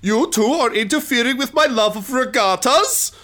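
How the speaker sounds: high, loud villain outburst; villain's voice. Acted. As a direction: put on a loud, high-pitched bad guy. Game master voicing the villain.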